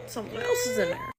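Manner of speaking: high mock-ominous singsong voice; high-pitched repeat. Storyteller echoing the missed-period warning, in a high put-on voice.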